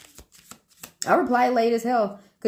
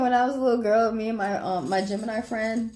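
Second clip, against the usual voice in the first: ordinary talk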